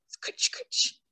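whispered scritching noise; scratching sound. Acted. Narrator makes the dog's pawing sound. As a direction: scratch at the dirt with the voice. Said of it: "he pawed the dirt".

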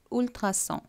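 French "ultrason", ül-tra-son, nasal ending; rounded u and nasal on in "ultrason"; French pronunciation. Shown as a demonstration. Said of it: "அதை நீங்க".

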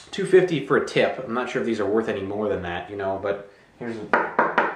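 usual speaking voice

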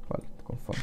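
soft unvoiced whisper. Real, not acted. Gamer whispering to viewers that he is hungry. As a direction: murmur it as an aside. Quiet aside during game.

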